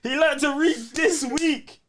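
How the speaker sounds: high, slow, drawn-out tease; teasing mock announcement. Real, not acted. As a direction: announce it like a big achievement to rib him. Mock-announcing friend learned reading.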